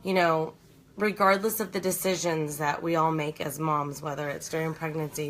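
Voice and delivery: ordinary delivery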